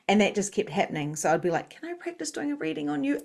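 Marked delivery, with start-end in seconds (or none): 1.7-3.2 high, timid request, retold; petrified little girl. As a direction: ask it small and high-pitched, mock-petrified.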